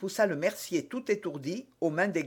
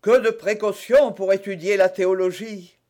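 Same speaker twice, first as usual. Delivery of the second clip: loud, higher mocking exclamation; 4 semitones higher, 8 dB louder than usual. A narrator's reading. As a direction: exclaim it loudly, pitched up. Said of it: "s'écria Porthos". (exclamation sonore)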